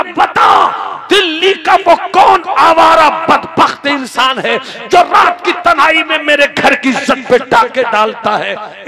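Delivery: furious roar. Acted. Husband furious accusation.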